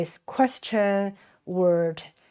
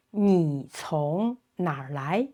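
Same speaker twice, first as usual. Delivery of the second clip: ordinary delivery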